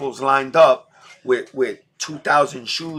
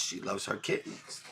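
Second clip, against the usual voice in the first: whispered; 5 semitones lower, 16 dB quieter than usual. Real, not acted. Repeats it, almost whispered.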